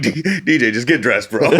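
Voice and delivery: pleading with a laugh; exasperated. Retold or imitated. Telling naked buddy to dress.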